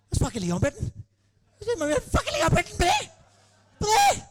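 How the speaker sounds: high, excited starstruck gush. Retold or imitated. Host reliving meeting his football hero, excitedly. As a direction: lose your mind at meeting your hero. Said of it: "I was losing my fucking mind".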